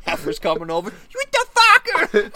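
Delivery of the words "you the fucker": slow, high, loud heifer yell; high mock heifer shout. Heifer coming over to yell at the golfer, high mock shout.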